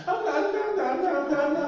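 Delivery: high, loud anguished no, no, no; crying no. Retold or imitated. Preacher voices the crying girl's refusals, high and loud. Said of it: "ella llorando me decía".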